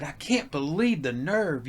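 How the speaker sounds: aghast at the nerve; slow indignant complaint. Retold or imitated. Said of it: "I told my wife, I said".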